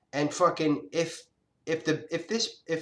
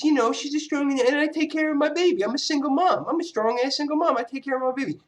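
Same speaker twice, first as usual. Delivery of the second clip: mocking the mother; 9 semitones higher than usual. Son mocking his mother's proud single-mom boast, high and sneering.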